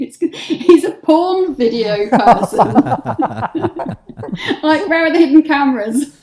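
realising the cameraman's shower is a porn set, laughing. Real, not acted. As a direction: laugh it out in disbelief.

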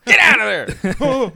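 high, loud, drawn-out yell; hollering. Acted. Friend trying out homeless hollering, long high yell. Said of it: "We should go homeless hollering".

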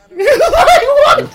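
high, loud laughing outburst; real laughing disbelief. Real, not acted. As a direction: squeal it through the laughter.

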